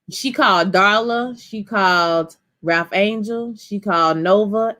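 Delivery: slow, leaning on each "called"; counting off everyone she called, with weight; emphatic list. Real, not acted. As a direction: stress "called" every time, slowly. Listing who she called.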